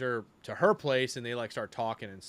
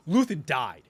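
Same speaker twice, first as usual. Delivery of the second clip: angry outburst; 3 semitones higher, 6 dB louder than usual. Retold or imitated. Acting Cassian's angry speech.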